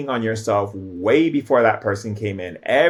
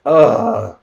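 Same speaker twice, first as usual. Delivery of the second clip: loud, higher-pitched groan; mock groan of frustration; spirit's groan; 4 semitones higher, 9 dB louder than usual. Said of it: "spirit's like".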